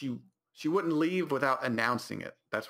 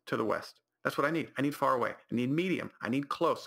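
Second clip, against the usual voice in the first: usual speaking voice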